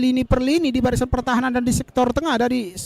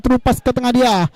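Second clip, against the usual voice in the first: usual speaking voice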